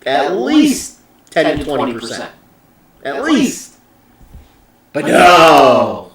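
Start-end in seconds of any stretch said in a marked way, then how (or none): 4.9-6.1 loud, fed-up "but no"; exasperated outburst. Real, not acted. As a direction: throw up your hands and shout it. Exasperated outburst about bad luck.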